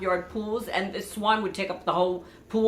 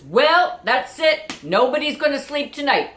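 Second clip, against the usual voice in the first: much higher, louder mock-fatalistic announcement; dad proclaiming; 8 semitones higher, 7 dB louder than usual. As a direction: declare the night lost grandly. Father's mock resignation by bulldozer.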